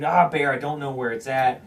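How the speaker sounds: ordinary talk, nothing marked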